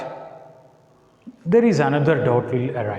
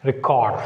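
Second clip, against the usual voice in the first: reCORD, with weak "ri" and stressed CORD; verb stress on second syllable; 2 semitones lower than usual. Shown as a demonstration. Teacher demonstrating the verb, stress on second syllable. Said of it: "make the difference by pronouncing them".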